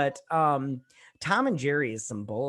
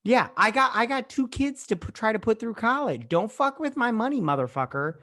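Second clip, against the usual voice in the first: heated rant; 7 semitones higher than usual. Real, not acted. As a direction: hammer the point home. Continues money rant.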